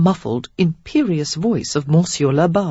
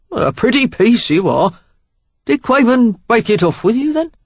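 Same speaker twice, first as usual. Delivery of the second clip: louder, cheeky Cockney flattery; flirting Cockney; 6 semitones higher, 5 dB louder than usual. A narrator's reading. Stranger admiring Sarah, flirting in Cockney. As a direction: chat her up like a London charmer. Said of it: "a healthy dose of Cockney in his accent".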